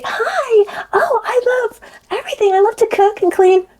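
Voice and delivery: put-on high, sugary little-girl voice; baby voice. Fake-sweet friend showing off, in a high baby voice. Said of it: "like a baby voice even".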